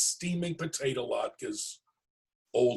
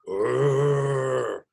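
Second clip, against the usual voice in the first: bear grunt; 5 dB louder than usual. Acted.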